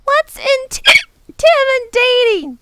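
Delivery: put-on very high, halting child voice; little girl Lindy. A narrator's reading. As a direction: stumble over the big word like a child. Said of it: "asked Lindy".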